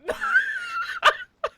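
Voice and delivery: high wheezing cackle; shrieking laugh. Real, not acted. Streamer reacting to the reveal, high cackling laugh. Streamer shocked by game twist.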